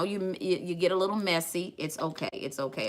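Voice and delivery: normal talking voice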